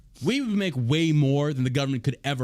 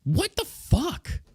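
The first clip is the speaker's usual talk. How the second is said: incredulous; 12 semitones higher, much slower than usual. Real, not acted. Disbelief at the news item.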